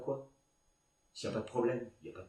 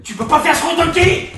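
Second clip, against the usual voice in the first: loud, high shout; angry scolding; 8 semitones higher, 13 dB louder than usual. Acted. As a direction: snap into a furious yell. Director berates actress.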